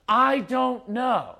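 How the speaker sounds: put-on high, drawn-out child's reply; whiny kid. Child asked about feelings answering parent, exasperated and drawn-out. Voicing kids' stock answer.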